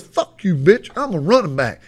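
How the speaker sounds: imitating a kid cussing out the coach; defiant cussing. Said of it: "cussing this goddamn coach like a grown-ass man".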